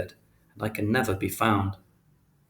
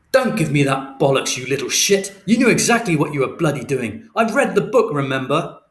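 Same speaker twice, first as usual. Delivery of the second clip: loud, high angry shouting; furious father; 4 semitones higher, 7 dB louder than usual. A narrator's reading. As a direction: tear into him, cutting him off. Father furious, interrupts son.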